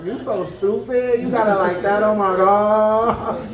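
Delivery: high, slow, coy and giggly; flustered flattered. Retold or imitated. Cook answers the flirting server, coy, drawn out and giggly. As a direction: drag out the words like you're blushing. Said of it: "I was like".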